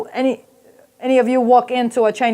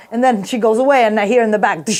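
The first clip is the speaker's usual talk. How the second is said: ordinary delivery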